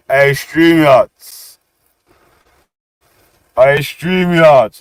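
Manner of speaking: slow, loud call; drawn-out shout to the stream. Real, not acted. Calling out to the stream.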